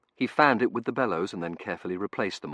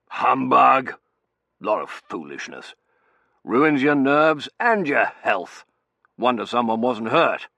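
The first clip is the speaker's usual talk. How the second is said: loud, emphatic scolding; raised, indignant husband's grumble; blustering colonel; 6 semitones higher, 11 dB louder, slower than usual. A narrator's reading. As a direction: dismiss it in gruff shorthand. Colonel scolding his wife.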